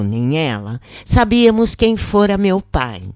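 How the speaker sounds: ordinary delivery, nothing marked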